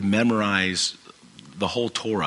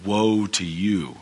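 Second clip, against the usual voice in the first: Woe to you drawn out slowly, weight on woe; much slower than usual. Retold or imitated. Jesus warning the Pharisees, slow heavy woe. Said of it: "And so he's saying".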